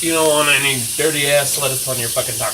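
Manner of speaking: loud, fed-up outburst; angry rant. Real, not acted. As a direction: bark it out in disgust. Ranting about dirty lettuce.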